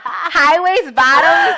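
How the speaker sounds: drawn-out, high mocking voice; mock disgust. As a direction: stretch it out in mock horror. Mock dismissive of granny style.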